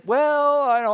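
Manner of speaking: high, loud caught-off-guard well; startled well. Retold or imitated. Pastor caught off guard by the dying woman, surprised. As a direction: stall in surprise. Startled reply to odd question.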